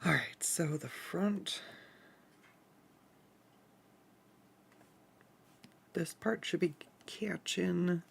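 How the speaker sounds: slow, unvoiced whisper. Real, not acted. Setting up lights for a shoot, quietly whispering. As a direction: murmur it while working.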